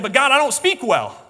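high, loud objection to God; protesting Moses. Acted. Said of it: "He said".

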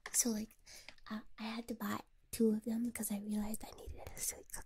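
soft close whisper. Real, not acted. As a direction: whisper it like a secret. Whispers throughout.

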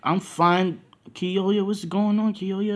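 high, loud worried questioning; alarmed concern. Acted. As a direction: pitch it up, urgent and concerned. Worried crew questioning him after outburst.

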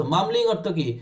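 normal talking voice

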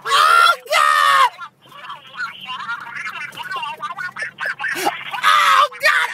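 high shrieking laughter; laughing scream. Real, not acted. Cracking up at the clip.

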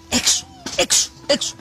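short, forceful mock order to get out; retold sharp shout. Priest at the altar door to a maskless woman, retold shout.

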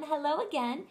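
usual speaking voice

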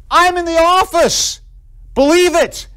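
high, slow, ringing declaration; acted emphatic proclamation. Pastor assuring a forgiven man, emphatically proclaiming.

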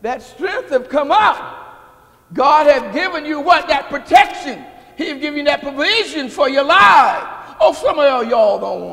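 slow, then roaring high and loud at the top; shouted preaching. Acted. Preacher shouting God's protection to the church, fervent roar. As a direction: whip the congregation up at full force.

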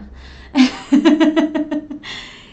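embarrassed laugh. Real, not acted. Laughs at forgetting Manu.

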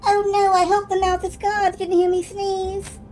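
high, anxious voice; worried character. Acted. Sneezing NPC worries.

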